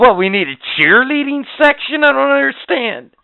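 high, loud incredulous rant; mocking cheerleading squad, high and loud. Real, not acted. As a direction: scoff at the whole idea, loudly. Ranting about cheerleaders.

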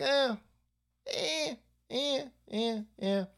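high, mocking little bleats; imitated "eh" noises. Host imitating awkward candidate, high mocking "eh". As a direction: repeat short, high whiny noises. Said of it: "And he's like".

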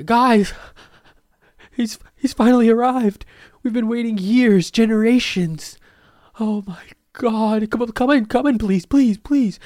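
very high, loud, pleading welcome; frantic high worshipper. Pretend. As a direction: beg him to come in, overjoyed. Congregation welcomes him frantically.

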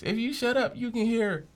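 high teasing mimic of his line; mocking classmates. Classmates teasing him about his mic line, high mimic. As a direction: parrot it back high like the jokers. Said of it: "they cracked jokes about that".

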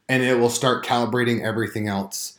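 usual speaking voice

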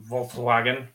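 usual speaking voice

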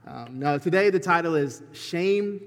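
usual speaking voice

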